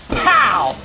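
sudden loud "pow" exclamation; hype shout. Real, not acted. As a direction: burst out with the impact. Hype shout on big cast.

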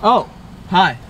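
ordinary delivery

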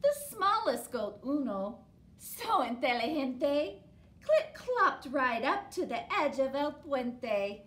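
put-on lively read-aloud for children; storybook reading. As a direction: tell it to the kids, bright and steady. Reads picture book aloud.

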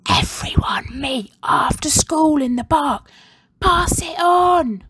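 secret whispered message. A narrator's reading. Girl whispers a secret plan to her best friend. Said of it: "Rebel whispered in her best friend's ear".

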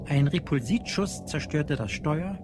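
ordinary talk, nothing marked

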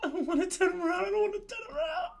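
high, whiny frightened pleading; scared whimpering. Real, not acted. Streamer too scared to turn round in the game, high frightened whining. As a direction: squeal with fear.

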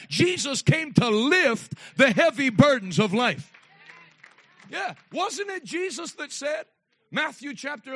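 loud, driving pulpit preaching; preaching at full force. Acted. Pastor preaching loud to the congregation. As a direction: preach it hard to the whole room.

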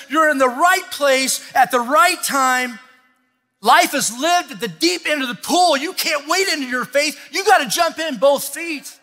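high, loud, shouting sermon climax; rally the congregation, shouting; preaching at full force. Acted.

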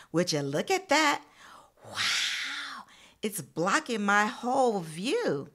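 put-on storybook voice for young listeners; reading to children. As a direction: read the picture book out to the class. Reads picture book to class.

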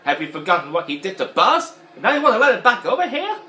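high, loud scolding toy voice; Mister Potato Head impression. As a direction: berate the other toys. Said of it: "And he's like".

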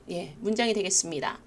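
ordinary delivery

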